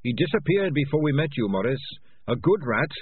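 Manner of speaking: lightly voiced small rat, remembering a friend; earnest rat. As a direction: voice an innocent rat. Voices the rat Dangerous Beans.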